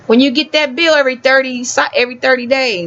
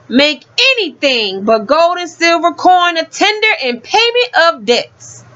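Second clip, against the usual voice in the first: every clause raised and pressed out, the last words slowed; read with emphasis; 3 semitones higher than usual. Shown as a demonstration. Reading the law to listeners, each clause emphasized. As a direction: read the law aloud like it's underlined. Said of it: "Now I'm gonna put the emphasis".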